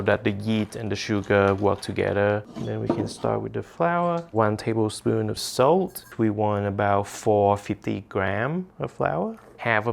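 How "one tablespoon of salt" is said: ordinary talk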